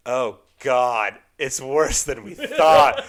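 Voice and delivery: loud, slow groaning dismay; mock despair. Mock despair retelling the bad movie to a friend. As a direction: drag it out in mock agony.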